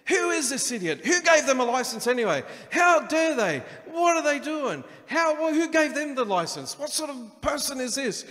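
high, loud road-rage rant at the other driver; angry driver. Retold or imitated. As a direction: yell it like someone just cut you off. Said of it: "so it's your job to get angry".

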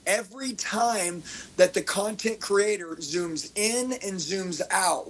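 normal talking voice

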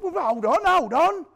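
loud, high plea to hang on; urgent calling out. Retold or imitated. Holding up bleeding Bill, loud urgent hold on. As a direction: shout it at someone who is fading.

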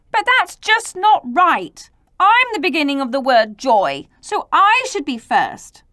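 high, loud objection; indignant protest. Acted. J disputing Y's claim to be first, indignant.

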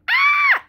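high terrified squeal; pig scream. Acted. Little pig fleeing the wolf, screaming. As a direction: shriek in panic.

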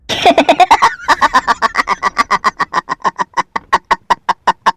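long drawn-out villainous laughter; villain laughing over plan to kill three men; gloating laugh. Acted. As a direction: savour the scheme with a laugh. Villain gloats over triple murder.

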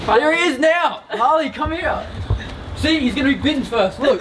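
high, excited shout across the room; excited calling out. Real, not acted. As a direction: call your mate over, thrilled. Calling friend over, excited.

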